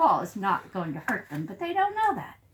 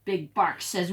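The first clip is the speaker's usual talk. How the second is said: ordinary delivery, nothing marked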